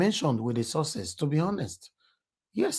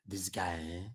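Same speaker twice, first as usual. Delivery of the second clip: hushed whisper; 8 semitones lower, 16 dB quieter than usual. Retold or imitated. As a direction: whisper it like gossip. Retold gossip in hushed voice.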